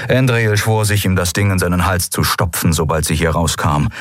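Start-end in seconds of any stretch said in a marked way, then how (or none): none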